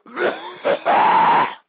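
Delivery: loud, harsh cough; coughing fit. Real, not acted. Host straining his voice on an imitation, loud cough. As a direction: cough hard from a strained throat. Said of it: "damn near tearing my voice".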